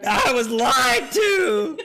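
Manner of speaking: slow, high, loud mock betrayal; mock outrage. Streamer to chat about the drink, mock shout. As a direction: wail it like a betrayed man.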